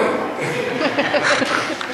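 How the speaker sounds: breathy burst of laughter; a real laugh at the memory; short laugh. Real, not acted. Laughs at own story.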